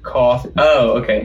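normal talking voice

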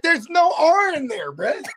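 high, loud "there's no R"; incredulous high protest; exasperated retort. Retold or imitated. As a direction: correct them with disbelief. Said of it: "I'm like".

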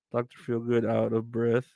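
usual speaking voice

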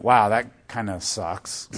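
low, slow, understated reaction; unemotional. Shown as a demonstration. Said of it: "that's not very emotional".

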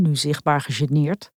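usual speaking voice

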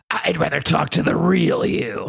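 slow, lightly voiced monster; a half-hearted try at a demon; bad monster voice. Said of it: "That's a terrible, I'm not gonna use that voice".

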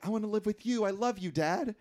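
lightly acted earnest child; Mike. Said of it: "Mike arrives, and he tells Stallone".

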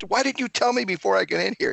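high, fast, hammed-up indignation; mock indignant. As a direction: squeak it out like you've been had. Retold protest at laughing friend.

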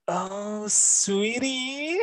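high, drawn-out condescending "sweetie"; mock pitying disappointment. Teacher greeting a former student in the shops, condescending. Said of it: "looked at me with disgust and disappointment".

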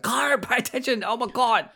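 high, put-on whiny nag for attention; impatient mock plea. Imitating himself nagging Kyron to watch, high and whiny. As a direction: act out pestering a distracted friend. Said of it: "just being like".

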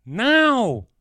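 put-on a boy's sudden, very loud high shout; yell. A narrator's reading. Boy yelling at Chris to come now, very loud. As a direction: scream it at him with all you have. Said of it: "Tommy yelled".

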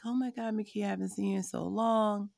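high, bright mock-gushing greeting; gushing neighbor. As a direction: play the overeager old neighbor lighting up. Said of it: "she's like".

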